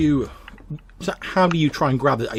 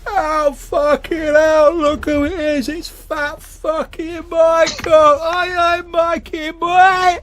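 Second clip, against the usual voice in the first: put-on much higher, louder, slow drunken bellow; drunk old man; 11 dB louder, much slower than usual. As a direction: roar it like a sloshed old family friend. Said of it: "He's like".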